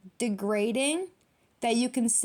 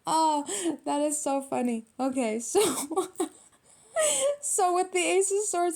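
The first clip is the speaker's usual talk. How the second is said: high, giggly, winding down from laughter; real laughing fit; 6 semitones higher than usual. Real, not acted. Reader resuming the card reading, still laughing. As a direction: gather yourself while still giggling.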